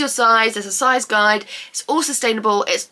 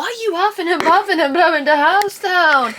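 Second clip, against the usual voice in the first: higher teasing dog talk; playful pet voice; 7 semitones higher than usual. Real, not acted. Teasing her panting dog.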